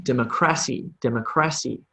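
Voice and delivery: "democracy" misstressed as dem-o-CRA-cy; stress on CRA. Shown as a demonstration. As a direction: hit "cra" in "democracy" instead of "mo". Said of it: "the word sounds wrong".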